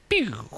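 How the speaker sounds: a quick mock laser zap, pew; sound effect. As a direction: zap the graphic onto the screen. Homemade sound effect for graphic.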